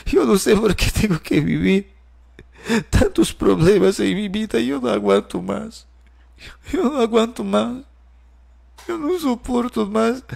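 voz quebrada y suave, más aguda, al borde del llanto; llorando. Retold or imitated. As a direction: suplica entre lágrimas que ya no aguanta. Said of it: "y yo comienzo a llorar". (ella llora ante Alejandro, desesperada y suave)

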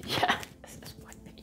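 a laughing hushed repeat; giggly whisper. Acted. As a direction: say it again as a giggling secret. Same line repeated as a secret.

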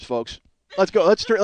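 normal talking voice

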